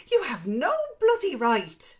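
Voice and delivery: angry and loud, just short of a shout; close to shouting. Acted. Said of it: "close to shouting".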